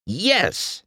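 high victory yes; thrilled rookie cheer; triumphant cheer. Acted. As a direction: celebrate the win.

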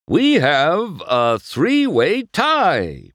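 slow, loud, high announcement; announcer. Acted. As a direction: drag out the news. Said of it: "Bob finished".

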